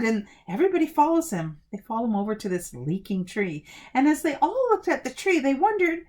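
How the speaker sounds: ordinary delivery, nothing marked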